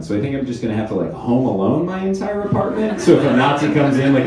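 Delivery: ordinary talk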